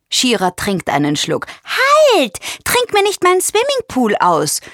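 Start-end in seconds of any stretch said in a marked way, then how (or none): 1.6-2.4 high, loud playful bird shout; much higher, louder chirped exclamation; chirping bird. A narrator's reading. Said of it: "zwitschert Froni und lacht". 2.6-4.6 high, playful bird voice; high-pitched little bird, cheekily indignant; chirping bird. A narrator's reading. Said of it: "zwitschert Froni und lacht".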